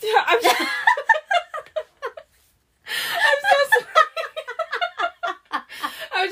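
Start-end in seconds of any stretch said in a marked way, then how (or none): 0.0-5.6 high, loud giggling through apology; can't stop laughing while apologizing. Real, not acted. Friend laughing while apologizing to Lee, giggling.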